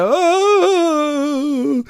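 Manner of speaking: put-on high, drawn-out childish oh; mock whimper. Preacher mimicking a child's fearful oh before a shot. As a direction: imitate a kid dreading the needle.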